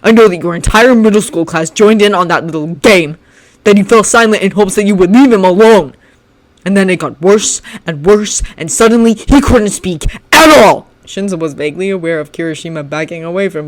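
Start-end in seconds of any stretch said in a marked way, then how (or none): 0.0-10.9 louder and louder furious rant; building tirade. Acted. Shinso ranting at Bakugo, growing louder. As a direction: pile on the accusations.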